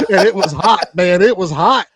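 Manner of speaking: laughing through the words. Real, not acted. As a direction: crack up while telling it. Laughing at own hot-wire mishap.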